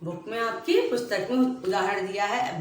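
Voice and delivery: ordinary talk, nothing marked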